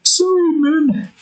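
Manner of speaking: high, slow put-on apology; Bill's high drawn-out apology as he skis off; Bill's sorry. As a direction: stretch out a high goofy sorry. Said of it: "Bill going out a little bit like a".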